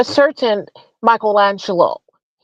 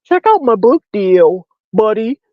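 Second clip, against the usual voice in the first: mocking impression. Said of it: "talking about".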